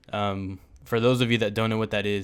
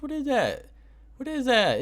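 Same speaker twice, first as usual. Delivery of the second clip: high-pitched disbelieving outburst; incredulous; 12 semitones higher than usual. Real, not acted. Vlogger ranting to viewers about an overpriced game, incredulous. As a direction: shoot way up in pitch, then drop, baffled.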